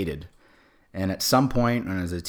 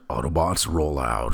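low, slow robot-leader voice; put-on heroic Transformer voice; Optimus Prime; 4 semitones lower, 4 dB louder than usual. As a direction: drop it deep and command slowly. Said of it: "work on my Optimus Prime voice".

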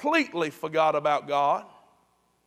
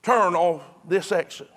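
GPS voice prompt; 5 dB louder than usual. Retold or imitated. Preacher imitating his GPS on a trip, announcing prompt. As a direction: lightly imitate a GPS giving directions. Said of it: "Then it says".

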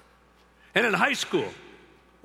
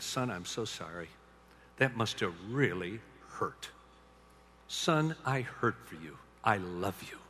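soft, slow, lower comforting words; tender comfort; 8 semitones lower, 7 dB quieter than usual. Retold or imitated. Father soothing his hurt son, soft and slow. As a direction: hold him and say it gently.

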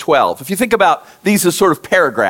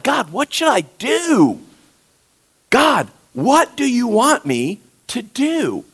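pleading prayer; 4 semitones higher, slower than usual. Retold or imitated. Voicing a desperate prayer.

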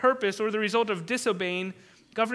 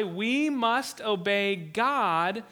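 very slow, each word weighted; slow solemn declaration; much slower than usual. Retold or imitated. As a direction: declare it with calm resolve. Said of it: "Peter and the apostles reply".

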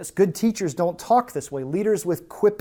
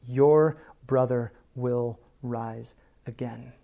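word by word, slow and lower; measured, solemn pronouncement; slow, deliberate; 4 semitones lower, slower than usual. Acted. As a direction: space out each word with weight. Quotes five words for weight.

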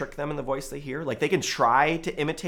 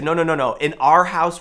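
firm loud reassurance; 4 semitones higher, 8 dB louder than usual. Retold or imitated. Father correcting child at home.

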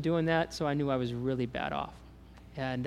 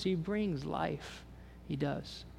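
softer, slow and earnest; quiet sincere; 8 dB quieter, much slower than usual. Real, not acted. Preacher quietly assuring the congregation the Spirit brings life. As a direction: drop down and mean it quietly.

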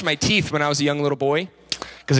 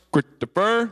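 Christopher in two punched chunks, higher, middle swallowed; stern manly dad; 5 semitones higher, slower than usual. Shown as a demonstration. Dad calls his son Christopher, imitated stern call. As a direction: imitate a father's abrupt summons on Christopher. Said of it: "a stern, manly voice".

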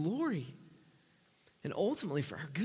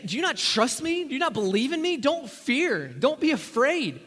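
raised, urgent questioning of the disciples; Jesus reassuring; 8 semitones higher, 7 dB louder than usual. Acted. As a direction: press them to trust. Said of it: "He'd say".

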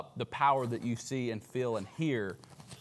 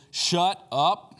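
louder, higher, forceful order; two firm, punched words; stern command; 4 semitones higher, 5 dB louder than usual. Acted. Jesus silences the demon, sternly. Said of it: "said Jesus sternly".

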